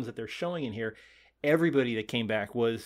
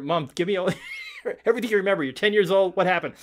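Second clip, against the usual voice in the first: loud, higher, playful grilling; mock urgent questioning; 7 semitones higher, 7 dB louder, faster than usual. Co-host acting out interviewing Mom, mock urgent. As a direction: press for answers in a hammed-up way. Acts out quizzing one's mother.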